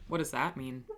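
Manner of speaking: usual speaking voice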